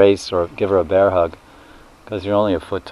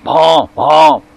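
higher, louder honking bleats; calf's honk; 7 semitones higher, 6 dB louder, much slower than usual. Retold or imitated. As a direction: honk like a calf. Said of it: "the calf's going".

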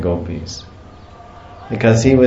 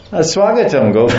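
mock greeting; 8 semitones higher than usual. Mocking the wrong greeting.

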